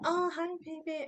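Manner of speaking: barely awake, sweetly dazed; drugged new mother. Retold or imitated. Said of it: "I was in under heavy drugs".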